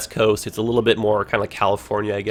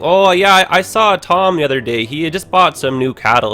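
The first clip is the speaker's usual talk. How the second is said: put-on rural Ontario accent; 4 semitones higher, 9 dB louder than usual. Explaining Canadian accents to stream chat, does a rural Ontario farmer accent. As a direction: play a small-town Ontario farmer chatting about his neighbour, in a folksy rural Ontario accent. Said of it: "that kind of stuff might happen".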